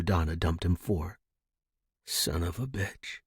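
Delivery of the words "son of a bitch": usual speaking voice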